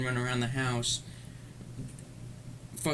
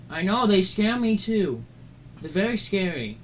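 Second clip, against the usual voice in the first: playful sympathy; 7 semitones higher, 4 dB louder than usual. Pretend. Commiserating with a scared listener, playful high cooing.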